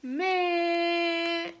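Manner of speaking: one long drawn-out Southern word, held level; imitated country boy. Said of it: "that's all he says".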